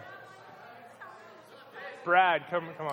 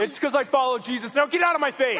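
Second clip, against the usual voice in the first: high, loud hammed-up outburst; mock preachy shout; 11 semitones higher, 7 dB louder than usual. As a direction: ham up a holier-than-thou snap. Mock retelling friend's rude reply.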